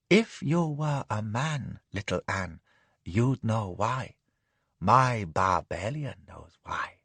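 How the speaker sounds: slow, low, soft teasing; teasing lady. Acted. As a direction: tease him lazily. Madam teasing.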